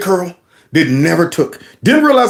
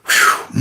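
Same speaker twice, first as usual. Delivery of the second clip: voiceless blown-out sigh; acted big exhale of relief; relieved whew. Boy rescued by mom slumps in car seat, acted relieved puff.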